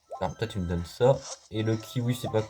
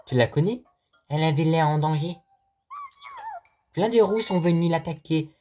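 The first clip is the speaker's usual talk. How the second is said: 1 character voice; 7 semitones higher, 4 dB louder than usual. Pretend. Voicing a game character.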